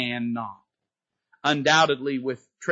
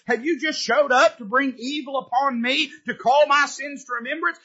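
higher, accusing mother's question; grieving widow accusing Elijah, raised and sharp; 10 semitones higher than usual. Retold or imitated. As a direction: throw the blame at the prophet. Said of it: "She looked at Elijah and said".

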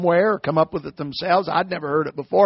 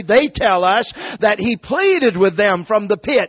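ordinary talk

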